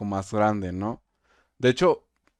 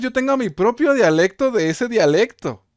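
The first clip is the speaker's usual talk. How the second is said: excited protest; 10 semitones higher, 7 dB louder than usual. Real, not acted. Excited objection to the teacher.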